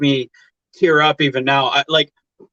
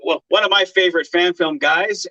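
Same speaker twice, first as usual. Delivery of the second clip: usual speaking voice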